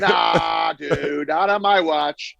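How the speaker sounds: slow, drawn-out mock tough guy. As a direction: drag it out like a self-righteous vigilante. Continuing the bigot parody.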